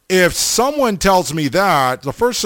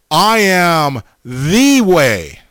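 slow "I am", then lean long and hard on "the"; stress on THE; 3 semitones higher, 3 dB louder, much slower than usual. Shown as a demonstration. Preacher quoting Jesus to his Igniters, stressing "the" as a long "thee". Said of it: "look at the emphasis on the word".

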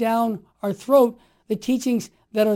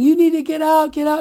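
loud mock yell, pitched up; retold screaming; 6 semitones higher, 5 dB louder than usual. Woman running the booths ordering him out, loud mock yell. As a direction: throw him out with a raised voice. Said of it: "starts screaming at me".

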